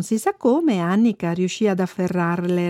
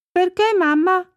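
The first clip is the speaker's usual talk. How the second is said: put-on high, questioning little girl voice; 10 semitones higher than usual. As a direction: ask Mum as a puzzled child, pitched high. Bambina che interroga la mamma. (voce acuta da bambina)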